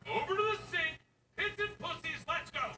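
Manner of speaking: high drill-sergeant order; barked order. Retold or imitated. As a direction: bark it like a coach. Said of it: "I go".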